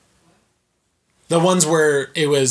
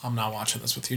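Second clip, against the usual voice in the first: quiet refusal; 3 semitones lower, 10 dB quieter, faster than usual. Retold or imitated. Retold remark to partner.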